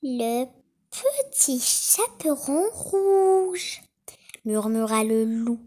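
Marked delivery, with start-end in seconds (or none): 0.0-3.8 higher, very slow put-on little girl; the wolf fakes a child's voice; wolf faking girl. A narrator's reading. Wolf at the door pretends to be the girl, slow and high. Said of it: "en imitant la voix de la fillette".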